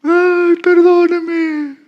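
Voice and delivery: girl bursting into tears before the pastor, imitated; mock crying. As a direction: wail for forgiveness like a caught girl. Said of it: "empezó a llorar".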